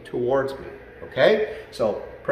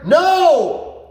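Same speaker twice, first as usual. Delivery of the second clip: loud, much higher NO!; forceful no; 14 semitones higher, 6 dB louder than usual. Shown as a demonstration. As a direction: show how the big no sounds. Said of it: "That's how I say it".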